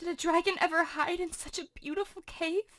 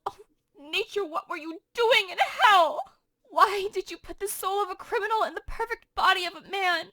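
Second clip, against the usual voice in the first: Juliet, shocked and torn. Acted. Juliet rails against Romeo, then despairs. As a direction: cry out at Romeo's betrayal, then sink into disbelief. Juliet reacts to news of Tybalt.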